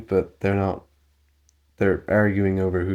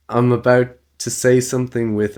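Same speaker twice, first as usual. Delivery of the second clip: normal talking voice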